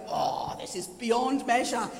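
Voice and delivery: low, slow, weighty wonder; awed exclamation. Acted. Preacher voicing Paul marvelling beyond measure, awed. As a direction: draw it out in amazement. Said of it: "He's going".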